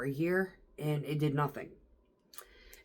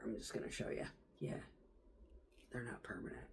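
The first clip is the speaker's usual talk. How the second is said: soft unvoiced whisper; whispered aside; 7 semitones lower, 21 dB quieter, faster than usual. Real, not acted. Speaking to the camera while showing the nails, hushed whisper.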